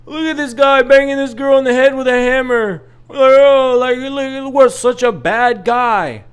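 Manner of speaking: high, loud sarcastic mock voice; mocking onlookers. Dad mocks the crowd that paints him as the bad guy, high and loud. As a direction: parody the judging crowd, shrill with disbelief. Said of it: "then it's like".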